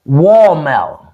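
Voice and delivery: slow WALL MELON with the ending dropped, "wall-mel"; under-enunciated "wall melon"; Southern watermelon. Shown as a demonstration. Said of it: "My husband says it like that".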